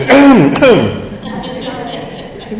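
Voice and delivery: loud, exaggerated throat clearing; high, drawn-out sympathetic hacking; imitated choking-baby throat clear. Preacher showing mums reacting to a choking baby, hammed-up throat clear. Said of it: "the baby choking and you".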